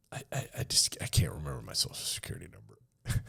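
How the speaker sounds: a stammering, barely voiced confession; sheepish whisper. Recruit confessing to his sergeant, sheepish whisper. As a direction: whisper it like you're scared to admit it.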